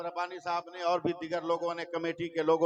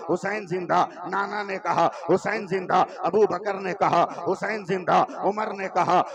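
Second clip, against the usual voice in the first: louder, driving call and refrain; rhythmic preaching refrain; 8 dB louder than usual. Acted. Preacher to the gathering, driving litany refrain. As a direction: build the litany beat by beat.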